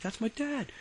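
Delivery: surprised recognition. Retold or imitated. Classmate spotting his cop dad in the raid, high startled blurt. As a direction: blurt it out in disbelief. Friend sees cop father.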